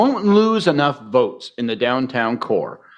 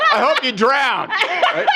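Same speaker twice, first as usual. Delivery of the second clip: laughing; much slower than usual. Real, not acted. Joking curse amid laughter.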